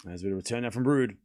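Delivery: ordinary delivery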